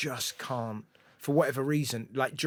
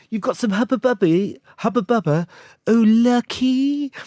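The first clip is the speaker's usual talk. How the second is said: high, loud imitation of West Country girl; shrieky mimicked West Country excitement; 10 semitones higher, 12 dB louder than usual. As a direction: belt it out high in broad West Country, drawing out the last word. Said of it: "I remember just hearing a girl".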